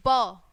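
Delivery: ब said as a short, punchy b sound; letter sound b. Shown as a demonstration. Teacher showing the class how B sounds, crisp and loud. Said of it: "कैसी sound निकलती है बी की".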